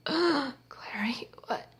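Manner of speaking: weak, whispered mock-dying words; hushed acted shock; stunned whisper. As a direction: act stabbed and betrayed, barely any voice. Acts out stabbed man's shock.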